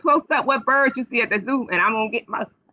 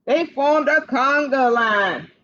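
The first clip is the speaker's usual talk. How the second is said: plain reading voice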